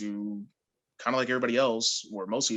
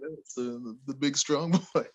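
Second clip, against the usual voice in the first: imitated smiling teasing; 3 semitones higher than usual. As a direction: tease with a little smile in the voice. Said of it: "he'd do his little smile".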